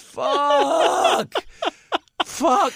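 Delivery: high, loud, drawn-out curse; hammed-up scream of frustration; exasperated yell. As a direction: wail it out in comic outrage. Acts out exasperation at the absurdity.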